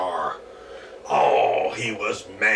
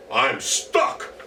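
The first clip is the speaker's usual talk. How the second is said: goblin shout; 4 dB louder than usual. Acted. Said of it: "the goblin shouted".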